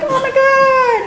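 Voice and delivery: high, hammed-up schoolgirl despair; mock anguished wail. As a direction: wail in mock horror at missing out. Girl learns she missed the boy.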